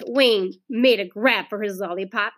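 ordinary delivery, nothing marked

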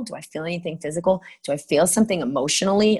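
ordinary talk, nothing marked

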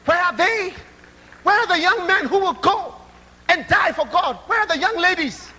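high, loud impassioned preaching; fervent pulpit appeal; preaching at full force. Real, not acted. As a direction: cry out to the congregation.

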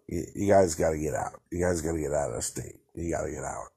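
hushed warning to get out; near whisper. Real, not acted. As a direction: lean in and urge quietly. Urging Oregonians to leave.

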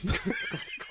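high laugh. Real, not acted. Laughing at dock joke.

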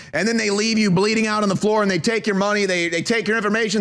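normal talking voice

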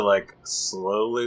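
ordinary delivery

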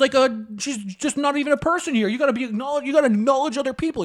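high, indignant scolding; imitated angry parent. Parent telling off eleven-year-old boy, high mock scolding. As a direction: keep up the hammed-up outraged rant. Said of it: "He starts going off on me".

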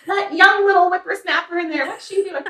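put-on high cackling old lady gossip; scandalized granny, laughing through it; little old lady voice. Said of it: "I love the little old lady voice".

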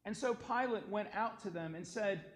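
ordinary delivery

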